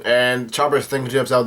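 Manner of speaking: plain reading voice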